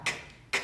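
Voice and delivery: "k" as a short voiceless burst; exploding K. Shown as a demonstration. Teacher demonstrating the C in car, "k" as bare burst. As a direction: pop each "k" on its own, no vowel. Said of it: "que la C explote como una K".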